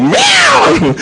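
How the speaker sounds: high squeaky meow; comic high meow; cat meow. Retold or imitated. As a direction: answer with a silly cat cry. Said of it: "Sabi ko".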